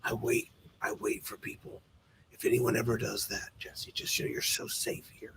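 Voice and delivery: menacing unvoiced whisper; creepy whisper. Father reassuring his young son, menacing whisper. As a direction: hiss it softly like a creepy dad. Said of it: "he'd be like".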